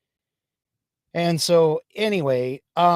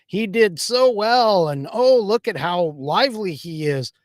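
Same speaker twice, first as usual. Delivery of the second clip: higher-pitched imitation of excited praise; mock gushing; 6 semitones higher, 3 dB louder than usual. Imitating leftists raving over Biden's speech, mock gushing. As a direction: gush in a mocking, raised voice. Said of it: "screaming and hollering over the fact".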